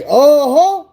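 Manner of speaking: high, sing-song taunting uh-huh; mocking uh-huh. Officer taunting the cuffed man, mocking high uh-huh. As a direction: tease him like the cop did.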